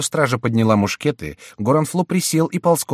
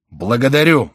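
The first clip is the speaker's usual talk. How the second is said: raised, loud cry of despair; 4 semitones higher, 8 dB louder than usual. A narrator's reading. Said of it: "вскричал король в припадке отчаяния". (крик отчаяния)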